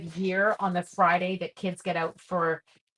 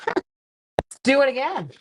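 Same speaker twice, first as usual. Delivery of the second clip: laughing; 7 semitones higher, 5 dB louder, slower than usual. Real, not acted. Laughs at repeating yesterday's stamp.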